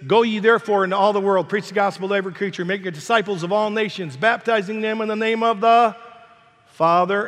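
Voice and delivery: quick, ringing verse recited from memory; scripture recitation. Acted. Preacher reciting scripture to the congregation, quick and ringing.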